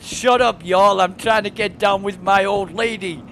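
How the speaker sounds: loud, high-pitched mock American yell; imitated American accent. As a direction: shout it as a caricatured American. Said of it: "he had a deep, deep American accent".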